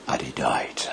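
whispered death at the cross. A narrator's reading. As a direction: breathe it out like a last breath. The death of Jesus retold.

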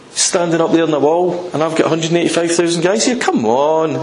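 high, needling challenge; taunting shout up. Acted. Enemy commander with his army below the wall, taunting call. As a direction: jeer upward at the defenders, goading them. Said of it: "and he shouts up".

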